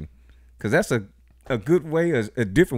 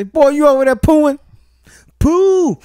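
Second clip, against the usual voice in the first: put-on high, loud exclamation at a kid; mock calling out; 10 dB louder than usual. Parent to kid filling his diaper, loud high-pitched mock call.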